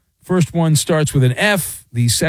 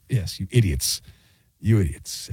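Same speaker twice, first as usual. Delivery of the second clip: low scolding; 8 semitones lower, 7 dB quieter than usual. Real, not acted. Growled scolding retort.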